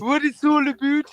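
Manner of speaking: high, bright imitation of a Canadian; Canadian accent. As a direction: echo it in a mock Canadian voice. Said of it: "It's not Canadian".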